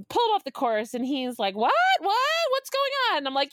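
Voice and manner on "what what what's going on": much higher, playing dumb; a caught thief faking surprise; mock confusion.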